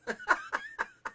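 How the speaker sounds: bright burst of laughter, pitch jumping way up; high laugh. Real, not acted. Host laughs at the joke.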